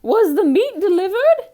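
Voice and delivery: very high, loud, put-on lady's voice; the flustered wife takes charge; Missus Rogers. A narrator's reading. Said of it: "asked Missus Rogers".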